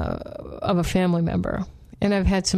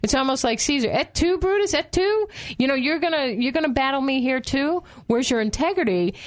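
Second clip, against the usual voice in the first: higher mock-outraged Job; hammed-up cry of betrayal, like Caesar; betrayed Job; 6 semitones higher than usual. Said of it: "It's like".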